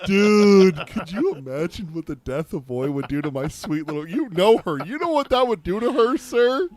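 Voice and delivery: real exasperated laughter; excited laughing rant. Real, not acted. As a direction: blurt it out half laughing, half outraged. Laughing outburst over daughter.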